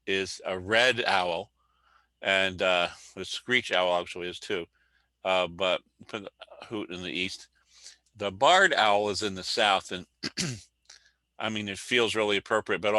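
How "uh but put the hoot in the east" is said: ordinary delivery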